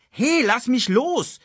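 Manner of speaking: high, drawn-out protest; called out. A narrator's reading. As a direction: cry out to be released. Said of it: "rief Simba".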